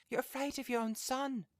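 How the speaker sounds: high, loud character voice; old woman crying out. A narrator's reading. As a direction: accuse him in a high put-on cry. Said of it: "she cried, struggling".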